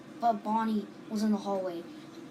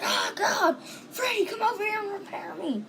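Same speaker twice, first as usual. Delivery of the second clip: high, whiny, damaged animatronic calling for help; lightly voiced robot begging to be fixed; broken robot pleading; 9 semitones higher than usual. Broken animatronic calls to Freddy.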